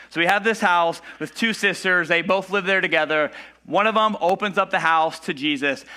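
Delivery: ordinary talk, nothing marked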